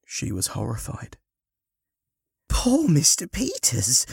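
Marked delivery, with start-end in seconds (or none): 2.5-4.1 high, drawn-out dismay in a woman's voice; shocked exclamation. Acted. Woman hearing of the sponsor's accident, exclaiming. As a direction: exclaim in horrified pity. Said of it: "she exclaimed".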